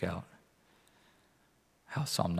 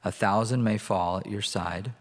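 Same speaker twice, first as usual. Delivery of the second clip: scripture reading. Acted. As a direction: read it out to the congregation. Reads Psalm 91 aloud.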